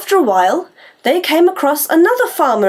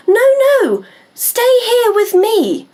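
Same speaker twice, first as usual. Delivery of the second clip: pleading; 9 semitones higher, slower than usual. Acted. Millie pleads, voiced by narrator.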